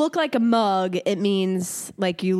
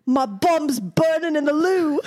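slower, hammed-up British voice, a bit higher; mock British accent; 5 semitones higher than usual. Joking about British words for toilet, mock British accent. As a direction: drag it out in a silly British voice. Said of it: "they call butt bum".

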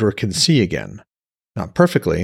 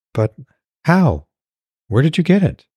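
amazed questions; 6 semitones higher than usual. Acted. Alara amazed, rapid questions.

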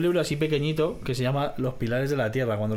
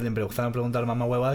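ordinary talk, nothing marked

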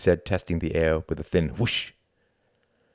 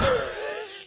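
high amused laugh; character's laugh. A narrator's reading. Klaus laughing at Tom's understatement. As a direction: laugh at the Englishman. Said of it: "laughed Klaus".

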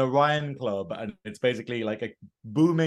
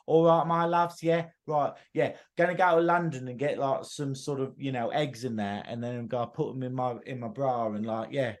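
put-on London-style Southern chatter; exaggerated Southern English vowels; Southern English accent. Shown as a demonstration. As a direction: talk like a cheeky Southern English geezer. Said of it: "when I try to talk, like, Southern".

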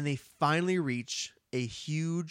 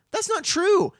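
excited protest; 7 dB louder than usual. Retold or imitated. Protesting in retold dialogue.

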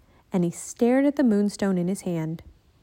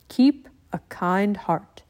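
calm ninja master; slower than usual. Acted. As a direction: speak like a wise old teacher.

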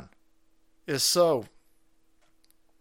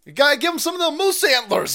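gleeful shout; 11 semitones higher, 6 dB louder than usual. Acted.

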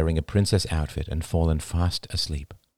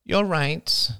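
high, sulky concession; 11 semitones higher than usual. A narrator's reading. Jada backing down after Diane snaps, sulky. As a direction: give in with a sulk. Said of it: "said Jada sulkily".

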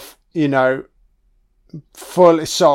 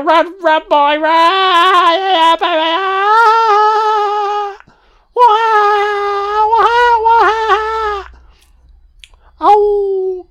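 high falsetto taunt; 4 dB louder, much slower than usual. Acted. Voicing a taunting gang member.

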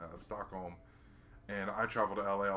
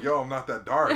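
laughing; 3 semitones higher, 10 dB louder than usual. Retold or imitated. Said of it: "I'm like".

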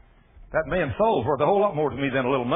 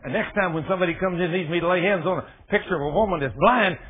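ordinary talk

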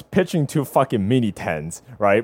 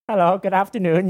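higher, put-on polite hello; mock polite greeting; 7 semitones higher than usual. Imagined greeting to a staring stranger, mock polite.

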